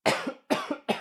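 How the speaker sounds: exaggerated put-on cough; fake cough. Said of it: "That was a fake cough, [name]".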